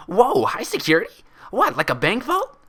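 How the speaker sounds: high, loud, bubbly gasp of excitement; excited Pinkie. Acted. Pinkie to Applejack, thrilled about a vault, high and loud. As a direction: squeal it like a kid who smells treasure. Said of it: "Pinkie gasped as excitement lit up her face".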